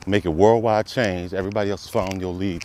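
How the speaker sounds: normal talking voice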